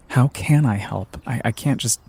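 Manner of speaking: ordinary talk, nothing marked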